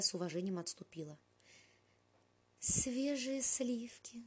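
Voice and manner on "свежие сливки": slow, breathy whispered longing; sighing whisper. A narrator's reading. Bella whispering at the sight of fresh cream. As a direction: whisper it with a slow dreamy sigh. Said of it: "вздохнула Белла".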